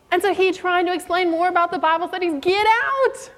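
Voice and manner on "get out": high mock shout of get out; imitating the angry woman yelling get out. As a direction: drive them off the porch.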